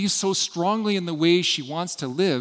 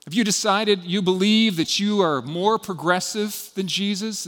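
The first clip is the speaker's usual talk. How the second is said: normal talking voice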